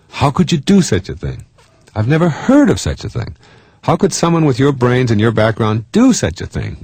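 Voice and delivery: high-pitched, acted disbelief; shocked and scandalized. Someone laying a guilt trap for a partner, scandalized. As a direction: play appalled at what the other did. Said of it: "I'm not only shocked and scandalized".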